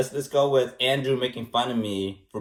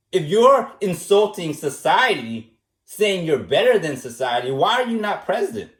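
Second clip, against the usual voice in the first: outgoing drama-class comeback; 3 dB louder than usual. Acted. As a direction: act out a grand, offended rebuttal. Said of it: "if I were to be outgoing, like a drama class".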